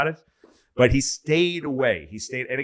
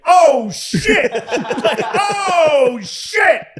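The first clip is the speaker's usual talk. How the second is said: high laughing yelp, far louder; startled bystander shout; 8 dB louder, much slower than usual. Retold or imitated. Bystander seeing the president walk in, laughing shout. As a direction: shriek it in shocked delight.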